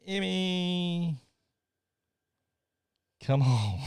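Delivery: high, drawn-out call. Real, not acted. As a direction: call her name in a long, high note. Calling absent co-host back.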